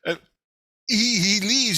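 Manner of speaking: ordinary talk, nothing marked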